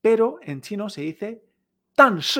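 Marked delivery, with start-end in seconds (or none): 1.9-2.4 slow, loud "tan shi", each syllable falling; two dry blows. Shown as a demonstration. Teacher modelling but, "tan shi" as two falling shots. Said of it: "dos golpes secos".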